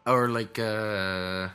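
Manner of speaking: ordinary talk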